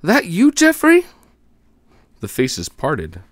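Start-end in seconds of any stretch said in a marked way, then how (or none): 0.0-1.0 high, loud call from afar. A narrator's reading. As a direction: shout the name across the street. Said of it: "A voice called".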